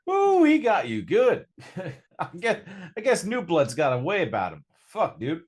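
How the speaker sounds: high, loud, cackling gang buddy; [name] laughing. Acted. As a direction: tease the new kid, loud and high.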